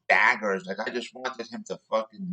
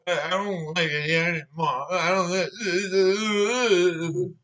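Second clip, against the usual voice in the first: hammed-up baby tantrum, higher and sobbing; mock crying; 5 semitones higher than usual. Worker caught in his lie gets into baby temper tantrum, mock crying. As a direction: imitate a blubbering, whiny cry-baby. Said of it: "going to almost crying".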